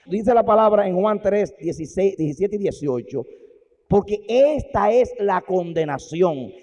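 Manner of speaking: loud, slow preaching. Real, not acted. Preacher hammering the message to his congregation. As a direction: drive the point home.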